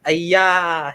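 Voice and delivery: imitating a startled gasp of fear; scared cry. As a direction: cry out in retold alarm.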